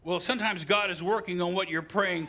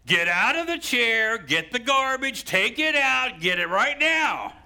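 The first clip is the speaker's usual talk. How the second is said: loud, high demanding order; wife's real order; 9 semitones higher, 6 dB louder than usual. Retold or imitated. Preacher voicing his wife ordering him to take out trash. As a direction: bark the chores out like a wife fed up. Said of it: "But what she's saying is".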